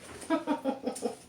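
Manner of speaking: light airy chuckle; a quick amused laugh. Real, not acted. Reader laughs at Owen.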